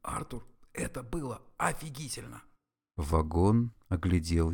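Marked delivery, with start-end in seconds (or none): 0.0-2.4 hushed aside, much softer; softly whispered praise to a friend. A narrator's reading. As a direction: whisper it so the captain can't hear. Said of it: "тихо шепнул".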